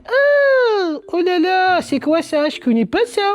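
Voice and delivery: high, squeaky dismay at a strange topping; surprised squeal. Retold or imitated. A man facing anchovies, voicing himself in high shock. Puzzled self over anchovies.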